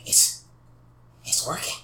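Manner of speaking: hushed, breathless wonder; awed whisper. Acted. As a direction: whisper it in awe. Villain awed by the scarab.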